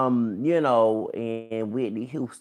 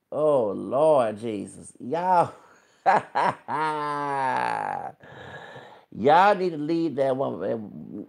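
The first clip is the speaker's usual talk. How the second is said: exclaiming; 2 semitones higher, 6 dB louder, slower than usual. Real, not acted. Scolding the rappers, laughing.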